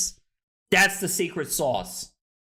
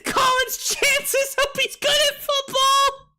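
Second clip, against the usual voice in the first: very high, squealing through laughter; wheezy, high-pitched hysterics; helpless laughter; 13 semitones higher than usual. Real, not acted. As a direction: struggle to talk while cracking up. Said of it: "Kyle's killing me right now".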